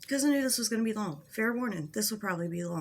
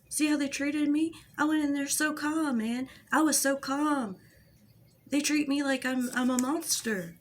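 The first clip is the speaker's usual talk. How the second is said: higher, mocking wounded-victim voice; playing the victim; 5 semitones higher, 4 dB louder than usual. Vlogger mimicking him complaining to followers, mock victim. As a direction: whine it higher, as the wronged innocent. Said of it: "he plays the victim".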